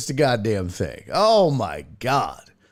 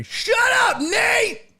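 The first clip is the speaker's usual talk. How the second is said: much higher, loud playful shout; mock yell; 3 dB louder, slower than usual. Real, not acted. Streamer to viewer Nate, playful yell. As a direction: yell at a teasing friend.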